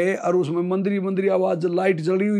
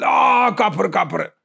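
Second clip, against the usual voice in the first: alarmed cry; 3 dB louder, much slower than usual. Retold or imitated. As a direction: imitate a startled captive crying out. Captured militant wakes and cries out. (घबराई हुई ऊँची चीख)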